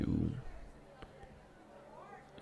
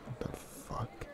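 stunned whisper under the breath; shocked whisper; 8 dB quieter than usual. Acted. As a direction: whisper it in disbelief. Shocked by listener's act.

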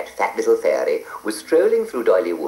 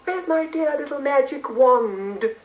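high flustered fairy; anxious fairy fussing. Acted. Fairy frets over the beast.

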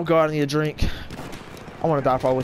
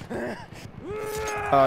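high mock uh; 4 dB quieter than usual. Mock taunt at enemy player.

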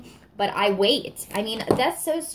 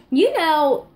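higher and louder burst; excited; 8 semitones higher, 7 dB louder than usual. Real, not acted. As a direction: jump in eagerly. Excited lead-in.

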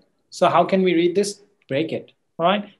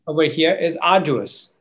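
ordinary talk

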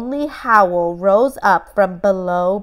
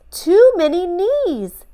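put-on high young boy voice, fretting; a small boy lost in a crowd, complaining; little Ben sighing at too many knees; 10 semitones higher, 3 dB louder than usual. A narrator's reading. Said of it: "said Ben".

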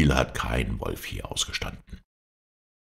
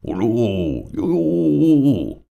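put-on high, loud drunken wolf howl; wolf singing as a dreadful howl; 8 semitones higher, 5 dB louder, much slower than usual. A narrator's reading. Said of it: "fürchterlich an zu heulen".

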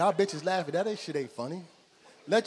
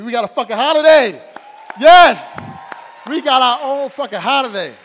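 much louder, higher cheering shout; 7 semitones higher, 17 dB louder than usual. Real, not acted. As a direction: hype the crowd at full volume. Cheering for Juneteenth.

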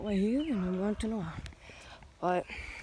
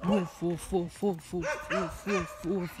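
mock dog barks; slower than usual. Playing with the dog, gentle mock barking. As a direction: bark like a friendly puppy.

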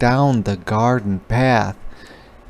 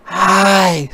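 bright spider greeting; 8 semitones higher, 4 dB louder than usual. A narrator's reading. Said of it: "said the spider".

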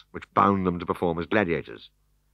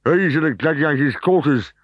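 brute trainer; 8 semitones higher, 6 dB louder than usual. Acted.

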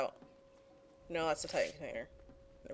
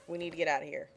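normal talking voice

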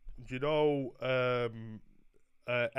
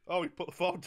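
ordinary delivery

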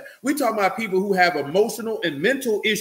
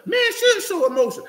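a lightly voiced macho man, pitched up; mock tough guy. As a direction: mimic the guys who say men shouldn't cry. Mocking a tough-guy saying.

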